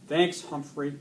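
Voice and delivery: ordinary delivery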